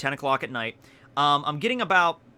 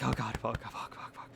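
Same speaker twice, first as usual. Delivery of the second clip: soft whispered panic; panicked whisper; 4 semitones lower, 12 dB quieter than usual. Retold or imitated. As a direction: breathe it out under your breath. Heart panicking after cop leaves.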